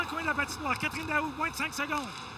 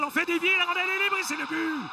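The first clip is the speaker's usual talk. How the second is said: excited sports commentary; 5 semitones higher than usual. Real, not acted. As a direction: build to the goal shout. Goal call.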